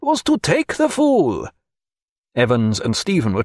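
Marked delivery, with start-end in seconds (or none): 0.0-1.5 put-on high, slow French-accented cry. A narrator's reading. Pontécanet scolds the players in a French accent. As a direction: lecture them in a French accent. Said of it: "cried Pontécanet".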